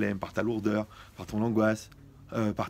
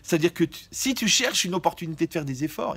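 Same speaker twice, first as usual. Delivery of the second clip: ordinary talk, nothing marked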